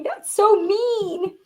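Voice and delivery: lightly voiced hurt kid; little kid's thought. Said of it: "as a little kid, I was thinking".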